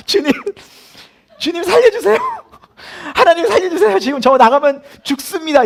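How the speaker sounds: loud, high comic pleading; panicked prayer. Retold or imitated. Frightened recruit silently begging God, hammed-up panicked cry. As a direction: beg for your life in hammed-up terror. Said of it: "계속 기도가 되는 거예요".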